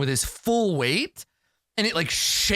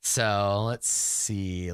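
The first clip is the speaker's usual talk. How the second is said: normal talking voice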